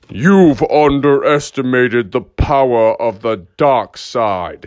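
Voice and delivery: slow, weighty villain pronouncement; ominous dark lord, each word drawn out; Darth Vader. Acted. Toy villain coming to life against the action figure, ominous. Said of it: "Darth Vader was like".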